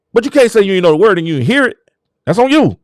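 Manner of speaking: ordinary talk, nothing marked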